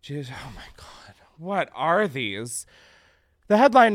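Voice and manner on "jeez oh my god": breathy shocked whisper; hushed disbelief. Real, not acted. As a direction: mutter it under your breath in disbelief. Shocked aside reading headline.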